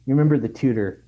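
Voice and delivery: pressing interrogation. A narrator's reading. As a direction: press them like an aunt grilling a suspect. Aunt grilling narrator about the tutor.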